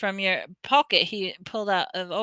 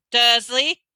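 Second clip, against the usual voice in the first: shout; 4 semitones higher, 6 dB louder than usual. A narrator's reading. Hagrid shouting at Vernon.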